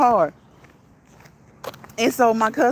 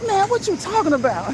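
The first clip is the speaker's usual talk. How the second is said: high, defensive protest; boyfriend protesting; 8 semitones higher than usual. Retold or imitated. Boyfriend denies it to his girlfriend, high protest. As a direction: play the caught boyfriend denying it.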